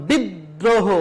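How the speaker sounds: বিদ্রোহ said slowly as biddroho, দ doubled; doubled d demo. Shown as a demonstration. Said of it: "দ বর্ণটা দুইবার উচ্চারণ করা হয়েছে". (দ্বিত্ব দ সহ বিদ্রোহ)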